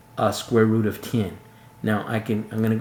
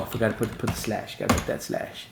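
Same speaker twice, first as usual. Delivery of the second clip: whispering a note to himself; quick whispered self-reminder; whispered aside; 5 dB quieter, faster than usual. Real, not acted. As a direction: drop to a whisper, talking to yourself.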